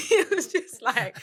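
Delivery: high laugh bursting through; can't hold back the laugh. Real, not acted. Retelling the jail joke to her friend, laughing. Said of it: "laughing while telling".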